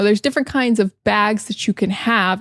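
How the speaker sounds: usual speaking voice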